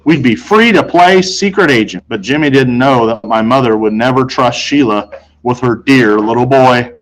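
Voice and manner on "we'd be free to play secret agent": plain reading voice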